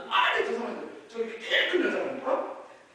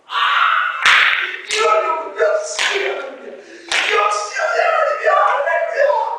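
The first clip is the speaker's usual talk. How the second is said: ecstatic praise; 10 semitones higher, 15 dB louder than usual. Acted. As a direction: shout it up to heaven, almost singing. Believer's joyful outburst acted.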